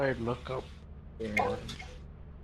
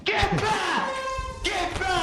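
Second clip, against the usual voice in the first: high, urgent shout; panicked yell; 14 semitones higher than usual. Real, not acted. Yelling at partner in panic.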